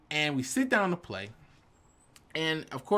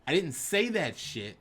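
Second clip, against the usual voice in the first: usual speaking voice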